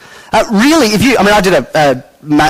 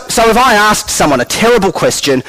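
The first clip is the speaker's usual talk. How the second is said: ordinary delivery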